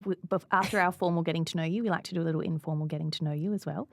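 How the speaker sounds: ordinary talk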